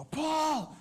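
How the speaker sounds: high, loud excited call of a name. Retold or imitated. Teammate calling Paul over, thrilled and loud. Excited shout spotting anaconda.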